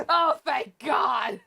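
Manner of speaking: slow cry of relief; relieved shout. Real, not acted. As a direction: yell out in relief. Relief after reveal.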